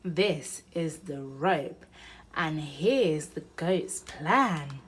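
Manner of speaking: plain reading voice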